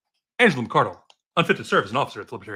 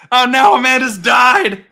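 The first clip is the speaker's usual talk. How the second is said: much higher, loud and slow; exclaiming; 10 semitones higher, 8 dB louder than usual. Real, not acted. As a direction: wail it out in mock despair. Dramatic mock cry.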